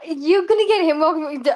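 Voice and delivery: normal talking voice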